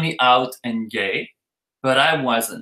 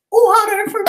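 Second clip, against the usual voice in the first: very high, loud comic falsetto; squeaky mock order; 5 dB louder than usual. Underage friend ordering from drag queen, squeaky mock order. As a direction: squeal it like a panicked little customer.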